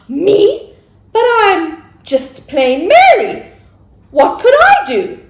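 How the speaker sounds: put-on high, slow, wide-eyed girl's voice; Mary's voice. Mary answering the angel, high and slow. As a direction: play young Mary, humble and amazed.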